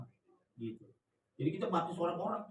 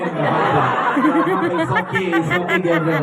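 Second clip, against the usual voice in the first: laughing reassurance; 18 dB louder than usual. Real, not acted. Laughing, reassuring Gabriel loudly.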